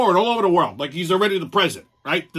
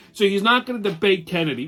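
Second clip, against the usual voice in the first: ordinary delivery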